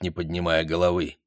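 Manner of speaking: ordinary delivery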